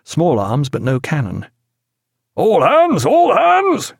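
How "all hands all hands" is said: high, loud captain's call; captain calling the crew to action; calling all hands. A narrator's reading. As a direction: rouse the whole ship with it. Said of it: "he called".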